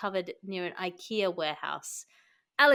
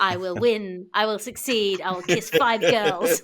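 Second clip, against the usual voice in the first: loud, laughing mock-aggressive chant; hammed-up aggressive self-affirmations, half laughing; aggressive mantras; 9 dB louder than usual. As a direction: bark the mantras like a pumped-up pep talk to yourself. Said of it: "like, really aggressive".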